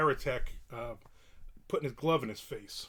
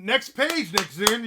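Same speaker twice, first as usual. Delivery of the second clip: loud call; 6 semitones higher, 8 dB louder, slower than usual. Acted. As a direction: call it out high and loud like an announcer. Calling out next page.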